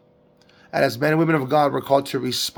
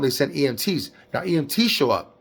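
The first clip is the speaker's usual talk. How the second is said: normal talking voice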